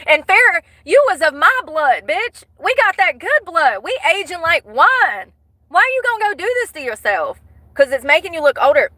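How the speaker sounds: high, fast, sassy scolding; high, fast, sassy family scolding; high scolding rant. Real, not acted. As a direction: lecture her like family gone wrong. Scolding Farrah as kin.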